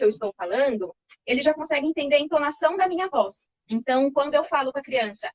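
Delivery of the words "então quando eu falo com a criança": ordinary talk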